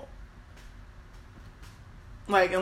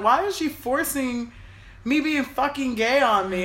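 higher-pitched, exasperated inner protest; retold upset protest; 6 semitones higher, 4 dB louder than usual. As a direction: replay being upset and fed up. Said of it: "getting emotional and crying".

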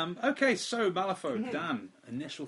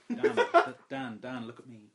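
lecherous; 8 dB louder, slower than usual. Retold or imitated. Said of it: "It's kind of lecherous".

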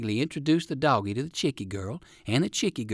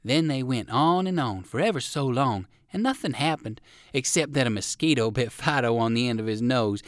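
plain reading voice

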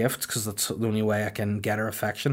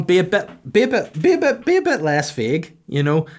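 exasperated; 7 semitones higher, 4 dB louder than usual. Real, not acted. Stammering at Helen.

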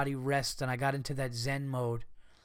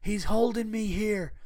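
mock hostage plea; 7 semitones higher than usual. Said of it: "as if a person is going".

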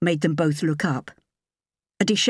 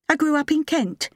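higher, lighter woman's voice; young woman voice; 11 semitones higher than usual. A narrator's reading. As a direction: voice her higher and clear.

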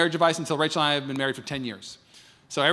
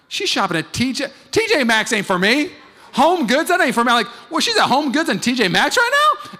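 high, loud mock outrage; raised indignant retelling of his jealousy; indignant rant; 9 semitones higher, 8 dB louder than usual. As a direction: huff it out like a jealous husband. Himself upset, retold.

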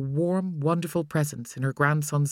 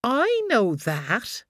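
put-on higher, slow scornful child; 7 semitones higher, much slower than usual. A narrator's reading. Boy scornful toward his worried mother. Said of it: "Cody said scornfully".

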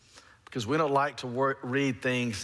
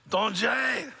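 raised voice; 10 semitones higher than usual. Retold or imitated. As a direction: yell it at someone who doesn't understand. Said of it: "He raised his voice".

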